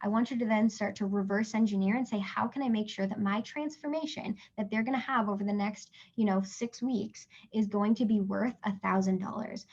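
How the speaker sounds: usual speaking voice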